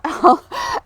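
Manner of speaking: bright rising laugh; short laugh. Real, not acted. Admitting she usually uses notes, short laugh. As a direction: let a laugh slip out.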